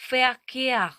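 "Verkehr" loud, starting with f, long stressed second syllable; German V as F. Shown as a demonstration. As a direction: model "Verkehr" as fer-KEH-ah, falling. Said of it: "вона читатиметься як звук Ф".

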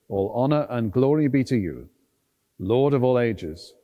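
ordinary talk

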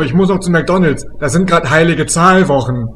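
usual speaking voice

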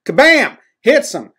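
high, loud burst; punchy impact call. Retold or imitated. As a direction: punch it out like a comic-book hit. Sound effect for the hit.

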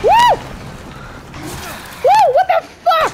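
very high, loud shrieking whoops; panicked screams. Real, not acted. Player attacked by a creature, shrieking in panic.

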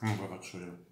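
talking between bites; speaking with food in mouth; mouth full, chewing. Real, not acted. Seen 2: Bite taken at -1.0; mouth works on food while speaking.

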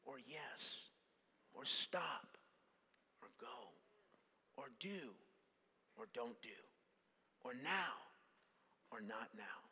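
very soft, separate short words with pauses; gentle quiet voice. Acted. Preacher voicing the Holy Spirit's still small prompts, very quiet. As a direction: let each option drop almost to nothing.

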